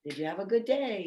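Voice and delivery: soft, quiet, gentle hello; hushed, tender welcome home; calm greeting. Shown as a demonstration. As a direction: greet the settled dog softly and calmly. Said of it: "then you can say".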